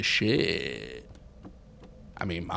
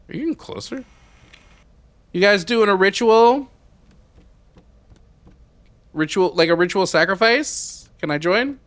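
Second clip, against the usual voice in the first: mock eager; 10 semitones higher, 8 dB louder than usual. Real, not acted. Mocking cultists outside.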